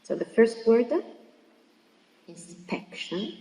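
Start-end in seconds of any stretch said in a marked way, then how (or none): 2.3-3.3 "inspection" slowly, stressing SPEC; teacher's stress demo of "inspection", in-SPEC-tion; stress on second syllable. Shown as a demonstration. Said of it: "stressed in the second syllable".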